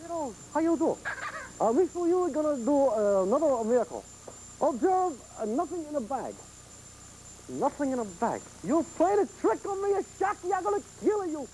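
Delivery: put-on a TV magician hamming a thick Polish accent. Horror host playing a Polish magician for the viewers, in a hammed-up Polish accent. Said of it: "Here I go to my Polish accent again".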